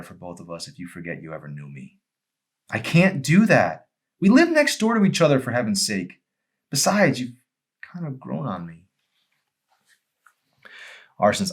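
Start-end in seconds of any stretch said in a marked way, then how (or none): 2.7-8.8 put-on higher, louder, insistent young woman; stubborn plea that softens at the end; a young woman's stubborn, tender refusal; Emery pleading. Emery refuses to leave him.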